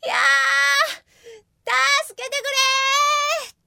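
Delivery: high, loud, sputtering through water; gurgling cry for help. Acted. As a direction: shout for help while going under. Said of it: "大声でわめきながら". (水にむせながら大声で助けを呼ぶ)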